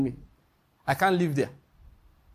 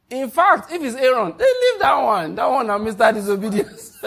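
excited, loud aside; 6 semitones higher, 6 dB louder than usual. Real, not acted. Excited aside about Aaron.

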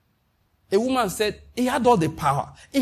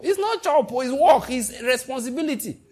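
emphatic correction; 5 semitones higher than usual. Real, not acted. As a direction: correct them hard, punch each phrase. Preacher correcting a misconception.

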